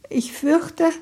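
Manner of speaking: high, fragile voice; frail, high-pitched dread; brittle voice. Acted. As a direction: let it sound thin and close to breaking. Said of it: "Ammas Stimme klang brüchig".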